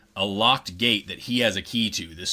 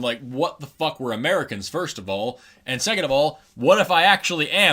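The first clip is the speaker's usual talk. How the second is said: usual speaking voice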